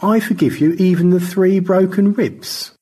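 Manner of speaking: gracious high thanks; George. Acted. George thanks both rescuers, genteel high voice.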